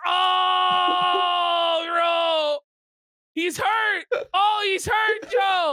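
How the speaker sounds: real wailing outburst of glee; excited shout. Real, not acted. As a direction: cry out in mock anguish for him. Reacting to Joe looking hurt.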